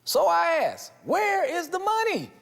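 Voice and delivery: where is the money asked high and slow; rhetorical question. Real, not acted. Host pressing the show's big question. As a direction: lean on money, rising up high.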